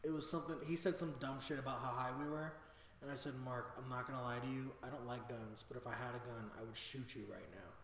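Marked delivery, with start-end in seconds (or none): 3.4-7.7 low, very soft deadpan; retold threat in a hushed, level voice; deadly serious threat. Frightened rider warning Mark, low quiet menace. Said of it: "'cause that was a threat".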